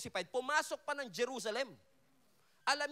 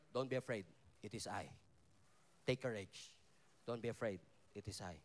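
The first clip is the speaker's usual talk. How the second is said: very low and soft, gentle comfort; reassuring Jesus; 13 semitones lower, 8 dB quieter than usual. Acted. Preacher voicing Jesus calming scared disciples, low and soft.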